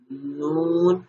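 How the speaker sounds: ordinary talk, nothing marked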